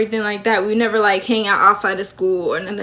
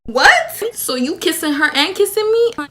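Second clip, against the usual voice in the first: high-pitched incredulous question; shocked disbelief; 7 semitones higher than usual. Acted. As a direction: shoot up high on the first word, then demand an answer. Acted shock at learning of cheating.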